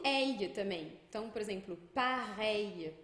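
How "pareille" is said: "pareille" slowly in French, ending in a Y glide; L como I. Shown as a demonstration. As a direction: say "pareille" stretched, no L heard. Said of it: "é o mesmo fenômeno". ("pareille" devagar, LL soando I curtinho)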